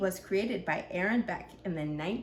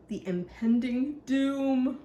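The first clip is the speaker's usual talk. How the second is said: impending doom; slower than usual. A narrator's reading. Storybook dread, drawn out.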